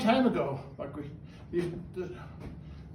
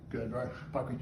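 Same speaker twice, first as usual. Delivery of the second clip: soft bird praise; 7 semitones lower, 8 dB quieter than usual. Real, not acted. Praising Bucky after its trick.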